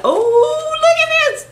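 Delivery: very high-pitched squeal; excited squeal. Real, not acted. Showing the next gift, excited squeal. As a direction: squeal in pure delight.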